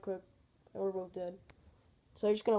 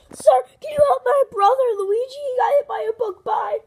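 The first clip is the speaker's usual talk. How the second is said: high, loud cry for help; frantic plea; 13 semitones higher, 11 dB louder than usual. Acted. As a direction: beg urgently for your hurt brother. Frantically begs help for brother.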